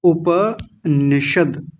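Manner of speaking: उपनिषद very slow, उ-प-नि-षद with full प and final द halant; correct pronunciation. Shown as a demonstration. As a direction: draw out उपनिषद syllable by syllable, full प. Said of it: "प को पूरा रखेंगे".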